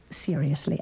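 ordinary delivery

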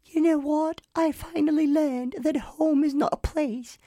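mock British kid's big sappy speech; sentimental boy. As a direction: deliver the moral like a tearful little boy. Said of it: "the boy turns around and it's like".

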